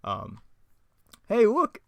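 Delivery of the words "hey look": high, loud cartoon slacker; Shaggy. Shaggy spotting a car, high cartoon voice. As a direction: call out in a squeaky put-on voice. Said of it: "I really got Shaggy down".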